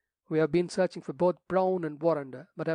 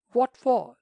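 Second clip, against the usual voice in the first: much higher, louder sharp question; sharp demand; 9 semitones higher, 8 dB louder than usual. A narrator's reading. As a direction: snap the question back in alarm. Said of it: "demanded the rector sharply".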